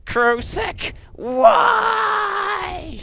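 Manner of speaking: high, loud dying shriek; acted death wail of a monster queen; screaming Hive Queen. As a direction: scream it out in anguish, stretching the last word. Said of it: "is the Hive Queen screaming".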